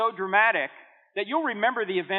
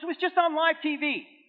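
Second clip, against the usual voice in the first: higher, a touch incredulous; pastor on the phone; 11 semitones higher than usual. Retold or imitated. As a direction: pastor stating the obvious, amazed.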